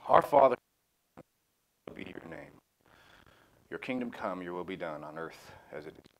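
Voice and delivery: a hushed, reverent prayer that fades toward the end. Acted. Leader closes the study by praying for the group, hushed and reverent.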